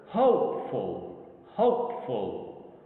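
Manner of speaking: "Hopeful" drawn out slowly, weight on HOPE; stress on first syllable. Shown as a demonstration. Teacher showing two syllable words, first syllable of "hopeful" stressed. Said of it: "the stress is in the first syllable".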